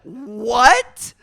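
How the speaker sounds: loud, high, drawn-out "what"; mock shock. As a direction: feign loud, scandalized disbelief. Mock shock at own slip.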